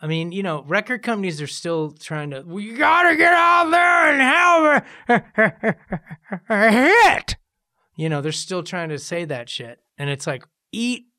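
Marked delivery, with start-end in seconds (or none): 2.4-7.4 high, very loud hammed-up bellow; mock boss shouting. Record company boss demanding a hit, shouted parody. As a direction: parody a pushy record executive yelling, breaking into laughs.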